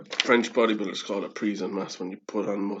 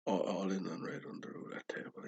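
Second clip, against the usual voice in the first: whispered bet; 7 dB quieter than usual. Retold or imitated. Le Pen betting at casino.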